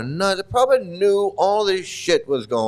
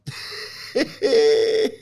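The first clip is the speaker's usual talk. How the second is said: silent breathy laugh; wheezing laugh; 11 semitones higher than usual. Real, not acted. Reader cracking up at the transcript, silent breathy laugh. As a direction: laugh without voice.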